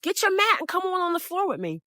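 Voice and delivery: man insisting she get on her mat; insistent man. Retold or imitated. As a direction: nag her onto the floor. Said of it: "he told her".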